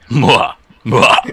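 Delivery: villain's gleeful mwah-ha cackle; evil cackle. Pretend. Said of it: "while I cackle".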